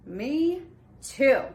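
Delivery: normal talking voice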